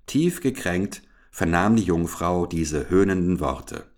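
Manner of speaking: plain reading voice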